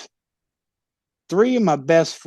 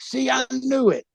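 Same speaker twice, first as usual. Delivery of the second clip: higher, lightly put-on gloating voice; a gloating higher sing-song; smug told-you-so; 8 semitones higher than usual. Wrestler gloating at wife, higher put-on told-you-so. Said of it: "I'm like".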